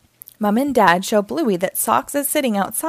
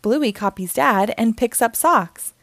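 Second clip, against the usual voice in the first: ordinary delivery, nothing marked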